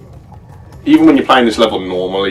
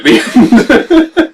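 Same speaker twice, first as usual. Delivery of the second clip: laughing; 8 dB louder than usual. Real, not acted. Laughing at video story.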